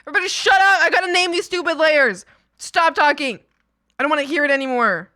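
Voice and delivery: loud, exasperated shout; mock angry yell. Real, not acted. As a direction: snap at the chat half-joking. Yelling at chat.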